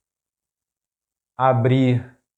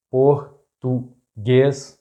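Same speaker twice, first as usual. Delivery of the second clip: português very slowly split, por - tu - guês; syllable by syllable; much slower than usual. Shown as a demonstration. Teacher breaking down an example word, português very slow in syllables. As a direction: break português into three widely spaced syllables.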